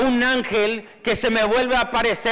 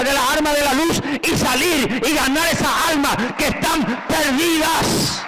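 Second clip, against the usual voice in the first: high, building shouted sermon; rally the flock with rising fire; rousing preaching; 4 semitones higher than usual. Acted. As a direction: pastor exhorting ministers to go win souls, fiery. Preaching at full force.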